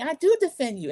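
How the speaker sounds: high mock protest; Martha arguing back. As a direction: imitate an upset friend defending herself. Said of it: "trying to do their accents and these loud, horrible arguments".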